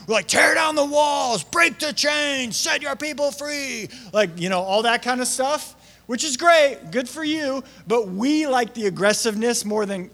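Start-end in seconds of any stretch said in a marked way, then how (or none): 0.3-3.9 high, slow rallying shout; aggressive. Retold or imitated. Worshippers demanding God act, slow high shouted chant. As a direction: roar it like a battle chant. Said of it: "big aggressive terminologies".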